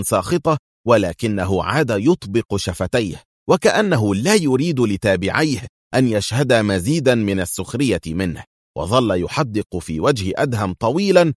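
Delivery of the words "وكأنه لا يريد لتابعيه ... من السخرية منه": plain reading voice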